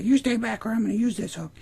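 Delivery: high falsetto warning; mock defiant woman. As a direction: imitate her threatening him in falsetto. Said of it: "And she's like".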